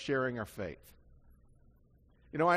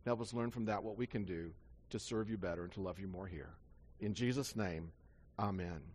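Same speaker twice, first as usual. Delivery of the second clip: soft, low prayer voice, drifting quieter; pastor's prayer; 4 semitones lower, 4 dB quieter than usual. Acted. Pastor praying over the congregation before the scripture, soft and low. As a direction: pray softly over the church.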